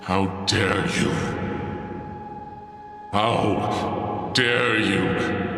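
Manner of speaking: slow, low, hammed-up How dare you?; mock offended Brit. Playing the offended Brit, slow low outrage.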